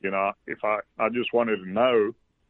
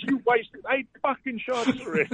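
very high, mock-outraged complaint; imitated indignant squawk on the edge of laughter; exasperated scolding; 14 semitones higher than usual. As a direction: retell the caddie's fed-up rant, voice shooting up. Retold caddie's scolding, teller laughing after.

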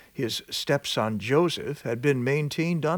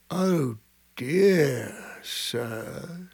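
a hint of an old man's dismay; lightly voiced sexton sighing oh dear; 2 semitones higher, much slower than usual.

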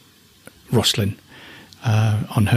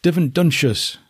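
higher-pitched imitation of a playground cry; 6 semitones higher than usual. Recalling a North East childhood phrase, imitated playground cry. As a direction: lightly mimic kids crying out in the playground, pitched up. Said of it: "the cry comes up".

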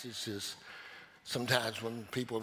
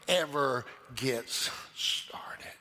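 very slow, hushed punchline; drawn-out, lowered finish; dramatic slow-down; much slower than usual. Real, not acted. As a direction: stretch it out and drop to a whisper.

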